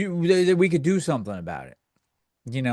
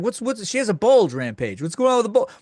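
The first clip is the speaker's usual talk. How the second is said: higher, teasing chat question; mock chat voice; 6 semitones higher, 3 dB louder than usual. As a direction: tease like a troll in chat. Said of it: "it's like".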